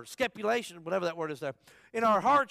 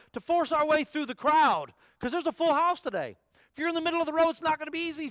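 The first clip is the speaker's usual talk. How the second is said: rising preaching; 6 semitones higher, 5 dB louder than usual. Real, not acted. Preaching building to a push.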